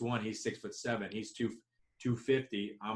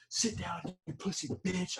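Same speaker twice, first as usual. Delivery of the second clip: mock taunting snarl, higher pitch; trash talk; 8 semitones higher than usual. Son taunting his father after a made shot, mocking and high. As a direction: rub it in after beating him. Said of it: "this is how I talk to my father".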